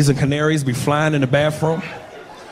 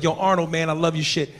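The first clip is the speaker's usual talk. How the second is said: normal talking voice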